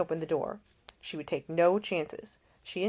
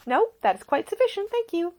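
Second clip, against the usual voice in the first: higher, brighter-pitched caring lady; a young woman tending a seasick man, solicitous and higher; Ida, gentle young woman; 6 dB louder than usual. A narrator's reading. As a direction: voice the attentive young lady nursing him. Ida speaking.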